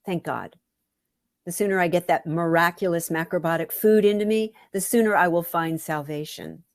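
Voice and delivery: plain reading voice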